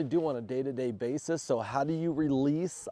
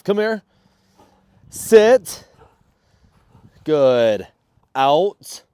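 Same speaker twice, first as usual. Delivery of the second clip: loud sharp dog commands; talking to a dog; 11 dB louder, much slower than usual. Real, not acted. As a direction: bark short commands at the dog.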